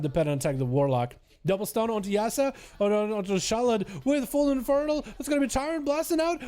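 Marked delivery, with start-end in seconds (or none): 1.5-6.4 excited play-by-play. Real, not acted. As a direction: pitch climbing as the attack builds. Live play-by-play, tension building.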